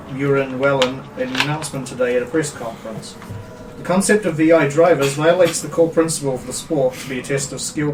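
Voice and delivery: steady newscaster delivery; news read. Acted. Reads in-game news bulletin.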